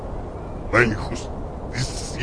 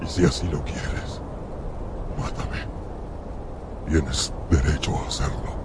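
quiet pleading; 4 semitones lower, 8 dB quieter than usual. Acted. Monster pleads with its creator.

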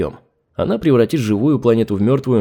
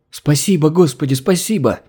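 fawning gratitude; 4 semitones higher than usual. Acted. Grateful servant thanks her god.

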